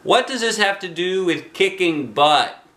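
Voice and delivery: put-on a boy's high, exasperated question; impatient demand. A narrator's reading. Arlo challenging Mister Jack, high and impatient. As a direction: push back, fed up.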